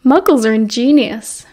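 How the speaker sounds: plain reading voice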